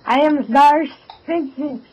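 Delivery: hammy fake Swedish accent. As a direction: put on a bad Swedish accent. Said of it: "putting on a very fake Swedish accent".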